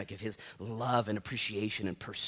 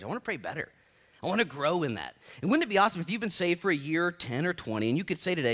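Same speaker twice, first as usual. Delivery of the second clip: ordinary delivery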